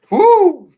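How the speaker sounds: high loud whoop of celebration; joyful whoop. Real, not acted. Host cheering after a powerful testimony with a big whoop. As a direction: let out a big cheering woo.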